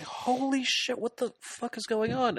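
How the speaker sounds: high mock squeal of disbelief; shocked outburst. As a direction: play it hammed-up, voice shooting up. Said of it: "he's like".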